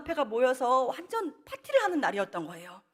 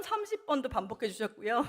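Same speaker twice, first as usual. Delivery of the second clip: ordinary talk, nothing marked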